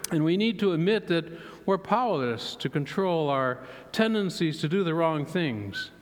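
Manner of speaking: normal talking voice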